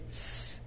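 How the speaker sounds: "s" as a drawn-out hiss; letter sound. Shown as a demonstration. Teacher showing the soft C sound, hissed "s". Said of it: "same sound as the letter".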